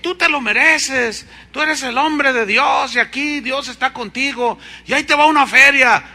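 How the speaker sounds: high, loud coaxing; pleading insistence. Retold or imitated. Naaman urging Eliseo to accept a gift, high and loud. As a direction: press him to take the gift. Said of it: "le instaba Naamán que aceptara".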